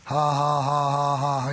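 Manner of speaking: low, monotone mock chuckle; steady, deep-pitched ha-ha-ha; Brother Hagin. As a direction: imitate a private laugh kept under the covers. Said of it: "he was just laughing real quietly".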